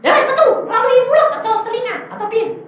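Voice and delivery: high-pitched, loud challenge; replaying an exasperated shout; heated confrontation.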